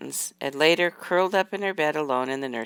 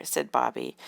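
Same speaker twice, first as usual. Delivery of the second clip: ordinary delivery, nothing marked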